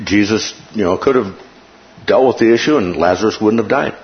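ordinary talk, nothing marked

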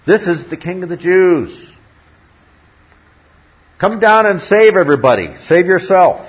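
loud, high jeering mockery; mocking crowd. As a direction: jeer like the crowd at the cross. Mockers at the cross.

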